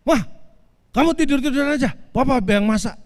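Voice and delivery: high, loud hammed-up husband's order; mock stern snapping. Husband orders wife to lie down, mock snapping. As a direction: bark at the wife in mock authority. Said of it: "pokoknya saya bentak-bentak".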